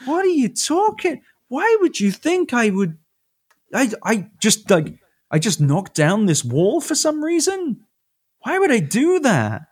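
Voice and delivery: a god who cannot believe what was written about him; baffled God. Retold or imitated. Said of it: "being like".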